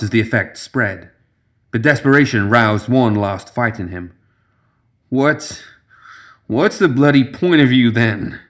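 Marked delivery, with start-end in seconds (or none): 5.1-8.4 drugged slurred speech; slurring; slurring drunkenly. Acted. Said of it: "he said, slurring his words".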